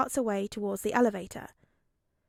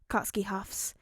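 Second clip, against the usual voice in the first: usual speaking voice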